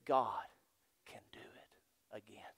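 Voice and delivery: drawn-out slow whisper. Real, not acted. Preacher sealing his point to the church, slow whisper. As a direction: let it fall to a slow whisper.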